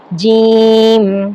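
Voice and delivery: جیم drawn out very slowly as a letter name; letter name demo. Shown as a demonstration. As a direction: stretch جیم out like reading a chart. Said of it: "جیسے یہاں پر ہے letter".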